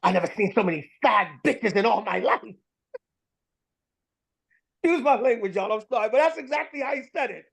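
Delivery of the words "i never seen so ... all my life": lightly voiced coworker, a touch put-on; quoting verbatim. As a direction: give his exact words in his voice. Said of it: "I'm gonna quote him verbatim".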